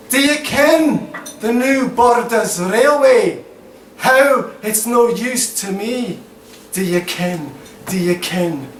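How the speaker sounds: put-on Scottish accent in verse; mock Scottish lilt from a Birmingham speaker. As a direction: perform it as a Scots poem. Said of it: "do the Scottish accents".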